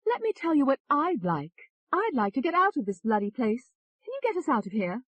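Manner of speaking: high, forceful English voice; English accent. A narrator's reading. Alter ego demanding to get out, high English accent. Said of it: "said in an English accent".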